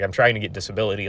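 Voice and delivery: normal talking voice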